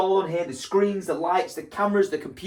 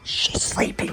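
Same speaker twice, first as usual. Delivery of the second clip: hushed, breathy whisper so as not to wake her; slower than usual. Real, not acted. Baby asleep, warns to hush.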